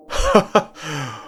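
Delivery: a bright, high burst of laughter; hearty laugh. A narrator's reading. Erwin congratulating the captain, laughing. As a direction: laugh up high, pleased with him. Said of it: "Erwin laughed".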